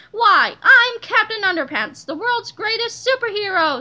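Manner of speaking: proud superhero. A narrator's reading. Superhero introducing himself to police chief, proudly. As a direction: announce yourself grandly.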